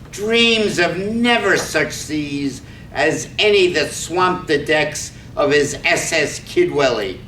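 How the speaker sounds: ordinary talk, nothing marked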